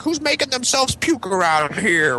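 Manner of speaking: high, drawn-out demand; imitated scolding teacher. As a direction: play a teacher hunting the culprit. Imitated teacher coming to investigate.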